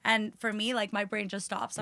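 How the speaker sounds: normal talking voice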